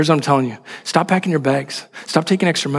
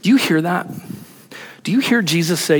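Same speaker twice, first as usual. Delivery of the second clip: ordinary delivery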